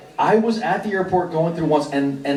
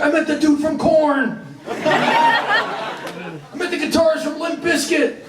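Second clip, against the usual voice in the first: higher, gushing mock fan brag, slowing at the end; mock excited guard; 11 semitones higher, slower than usual. Guard bragging to his wife about meeting famous rockers, mock gushing. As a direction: play the starstruck guard bragging. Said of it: "tell their wife, like".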